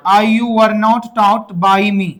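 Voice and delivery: very slow, word by word; slow model sentence. Shown as a demonstration. Teacher to his class, dictating the answer very slowly. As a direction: drag each word out for repeating. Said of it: "क्या बोलेंगे?".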